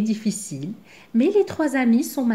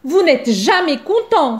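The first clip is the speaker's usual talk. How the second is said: much higher, louder, indignant; offended blackbird protesting loudly; indignant outburst; 6 semitones higher, 5 dB louder than usual. A narrator's reading. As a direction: blow up at the ungrateful passengers. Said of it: "s'insurge Zigomar".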